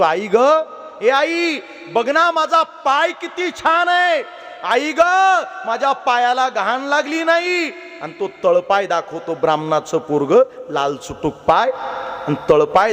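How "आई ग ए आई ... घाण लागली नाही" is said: put-on higher, eager child's voice showing off; proud little boy. Boy shows his clean foot to his mother, higher and eager. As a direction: play a delighted four-year-old boasting to mom. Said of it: "आईला तळपाय दाखवत बोलतो".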